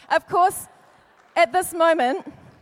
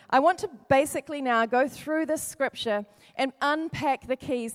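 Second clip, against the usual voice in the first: normal talking voice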